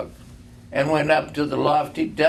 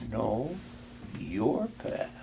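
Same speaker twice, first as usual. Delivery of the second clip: soft ghost; 10 dB quieter, slower than usual. A narrator's reading. Ghost speaks softly.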